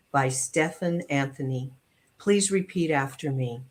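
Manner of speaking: ordinary talk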